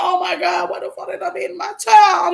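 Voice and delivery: high panicked wail, re-enacted; retold crying. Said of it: "I'm just crying".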